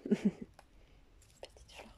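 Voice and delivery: breathy laughing whisper. Real, not acted. Laughing over a little flower.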